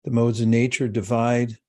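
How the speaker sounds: measured, calm reading of a sacred verse; scripture reading. Acted. Teacher reading a verse to the meditating class.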